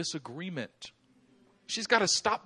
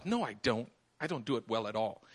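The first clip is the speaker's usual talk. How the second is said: lower, softer pouting denial; lightly voiced little daughter; a hint of a sulky girl; 8 semitones lower, 6 dB quieter than usual. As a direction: mutter it like a sulky child.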